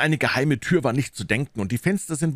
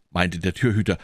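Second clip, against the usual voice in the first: usual speaking voice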